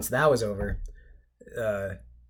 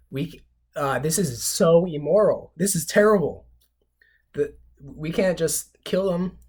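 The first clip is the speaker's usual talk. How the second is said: mock moral outrage; 5 semitones higher, 5 dB louder than usual. Arnold protesting slaughter.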